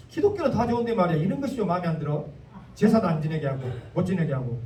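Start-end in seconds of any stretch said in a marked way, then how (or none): none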